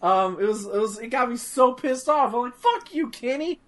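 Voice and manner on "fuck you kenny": higher, indignant cursing at Kenny; mock outrage. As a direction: relive being furious at Kenny. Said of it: "I was legit mad on stream".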